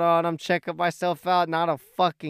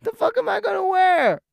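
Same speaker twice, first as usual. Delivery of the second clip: much higher and louder, furious yelling; angry outburst; 6 dB louder than usual. Retold or imitated. Yelling at his little brother for peeing on his clothes. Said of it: "I'm like".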